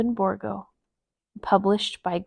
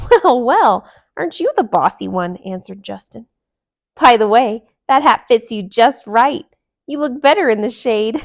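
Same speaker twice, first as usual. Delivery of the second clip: teasing; 2 semitones higher, 9 dB louder than usual. A narrator's reading. Joking jackal teases.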